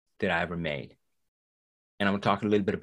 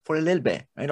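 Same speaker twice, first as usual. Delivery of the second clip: normal talking voice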